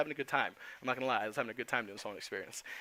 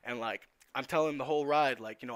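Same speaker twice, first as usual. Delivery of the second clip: usual speaking voice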